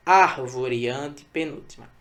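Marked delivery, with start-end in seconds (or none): 0.1-0.9 "árvore" com AR inicial forte; tônica em AR. Shown as a demonstration. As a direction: bata no primeiro som de "árvore". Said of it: "e sim o ar". ("árvore" com a primeira sílaba destacada)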